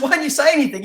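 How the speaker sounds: high, loud acted protest; incredulous retold protest. Storyteller replaying his protest to the supplier, incredulous.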